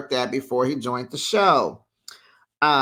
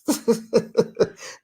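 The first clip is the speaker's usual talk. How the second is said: breathy laugh; 7 semitones higher than usual. Real, not acted.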